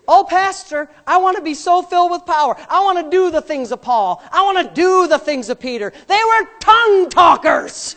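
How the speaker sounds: loud, high pleading into a shout; full-force preaching. Acted. Preacher voicing believers wanting Peter's power, full force.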